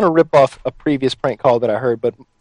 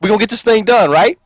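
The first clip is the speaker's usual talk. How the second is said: much higher, louder, spelling it out over the phone; loud repeat; 8 semitones higher, 3 dB louder than usual. Real, not acted. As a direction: raise your voice so the guy finally catches it. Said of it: "Say what?".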